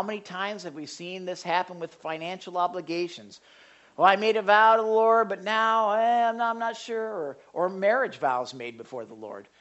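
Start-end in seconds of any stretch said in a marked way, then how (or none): 4.0-7.1 mock excuse-making. Someone backing out of a vow to the Lord, hedging. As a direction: voice a backpedaling vow-breaker, pitched up. Voices people excusing broken vows.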